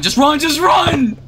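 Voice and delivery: high, rising yell to run; urgent shout. Real, not acted. Player urging teammate to flee, urgent high shout.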